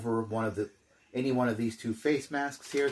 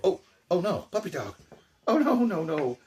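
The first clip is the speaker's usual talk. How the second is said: mock alarm; 8 semitones higher, 5 dB louder than usual. Playing dog attacking the figure.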